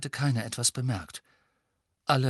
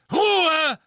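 high, very loud shout; thundering; 9 dB louder than usual. A narrator's reading. Mother cutting off the children's chant, thundering. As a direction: bellow for silence. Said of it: "donnerte Misses Weasley".

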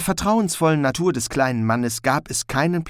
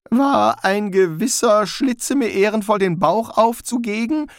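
higher, anxious question; Rincewind, wary; 10 semitones higher, 3 dB louder than usual. A narrator's reading. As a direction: ask it with growing dread. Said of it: "fragte Rincewind".

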